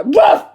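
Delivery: loud fake dog bark. Fake dog bark mid-interview.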